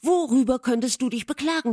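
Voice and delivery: loud, high, rapid challenge; quick outburst of a question; indignant exclamation. A narrator's reading. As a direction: demand an answer, raising the voice. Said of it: "rief Catherine".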